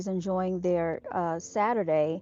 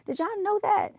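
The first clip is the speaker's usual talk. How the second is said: much higher, astonished question to viewers; wide-eyed real surprise; amazed; 13 semitones higher, 4 dB louder than usual. Real, not acted. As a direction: ask in genuine wonder. Surprised kids have e-bikes.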